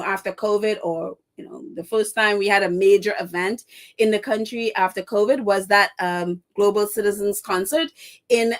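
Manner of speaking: ordinary talk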